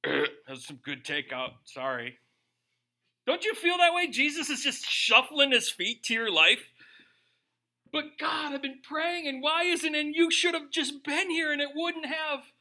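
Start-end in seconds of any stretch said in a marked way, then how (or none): 0.0-2.2 low, casual mock excuse; understated, sheepish comic reply; offhand apology. Jokey offhand Jesus answering angry sisters. 7.9-12.5 high-pitched mock whine to God; acted-out fretful grievance; complaining prayer.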